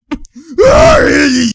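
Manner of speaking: long high, loud wail; drawn-out high-pitched yell; excited holler. Real, not acted. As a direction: cry out loudly at the play. Reacting to a play.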